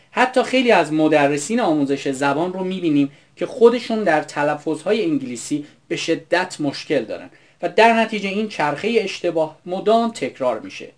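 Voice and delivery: ordinary delivery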